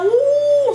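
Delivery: high, loud drawn-out "ooh"; a soaring hoot for things flung away; whooshing "uh". Retold or imitated. Showing viewers how far her laundry flew, high hooting.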